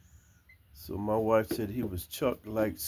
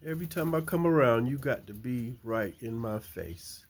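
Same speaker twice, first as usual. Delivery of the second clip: normal talking voice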